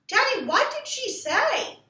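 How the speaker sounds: high, slow, pleading impatience; can't wait another second; impatient plea. Acted. Ramona pressing her father for the teacher's words, impatient. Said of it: "could not contain her impatience".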